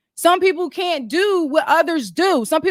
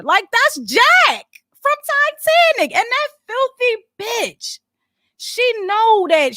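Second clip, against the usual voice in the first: mock outrage; 9 semitones higher, slower than usual. Mocking Titanic scene.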